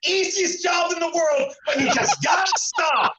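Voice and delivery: high, drawn-out mock rant; retold yelling. Golfer snapping back at a fan's remark, retold yelling. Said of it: "I start yelling at the poor guy".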